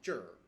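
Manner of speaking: 这儿 said as one syllable with the R curled on; Beijing R demo. Shown as a demonstration. Teacher to learners, demoing the Beijing R on 这儿. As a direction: curl 这儿 into a single R syllable. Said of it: "that extra E in the R is kinda dropped".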